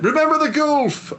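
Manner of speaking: high, hammed-up menacing line; imagined attacker. Joking about being mugged in London, high hammed-up attacker voice. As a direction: throw it out as a mock threat.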